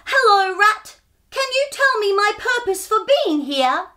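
high, loud call across the forest; shouting. Acted. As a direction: shout it out cheerfully. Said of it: "shouted Cobber".

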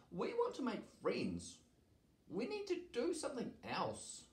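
high storybook dragon, softly insistent; dragon character Daisy. A narrator's reading. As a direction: urge them gently in a high voice. Daisy the dragon urges the others.

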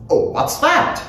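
high, sharp query. A narrator's reading. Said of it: "his sharp voice said".

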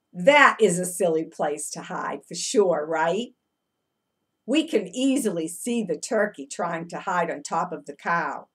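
ordinary delivery

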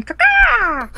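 very high squawking bird noise; silly bird call down the mic; imitated bird caw. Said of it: "making these weird clicks and whistles".

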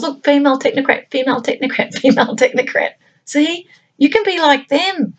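high, mocking sales-pitch voice for girls; sarcastic cheerful advert voice, high and sing-song; mocking promo voice.

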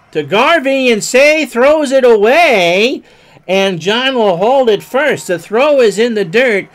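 loud, high excited call. Acted. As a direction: sell the wild throw loudly. Throwing error on play.

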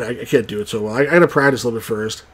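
plain reading voice

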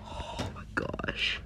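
slow, breathless frightened whisper; scared whisper. Real, not acted. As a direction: whisper it in real fear. Scared in horror game.

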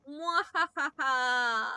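slow loud evil cackle; hammed-up villain mwahaha; panto villain laugh. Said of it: "Bask in my evilness".

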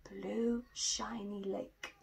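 plain reading voice

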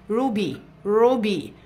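روبي said slowly with a long oo; oo sound. Shown as a demonstration. Teacher slowly modelling روبي with the oo. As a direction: stretch روبي as roobi. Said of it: "صوتها او".

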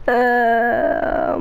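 ordinary delivery

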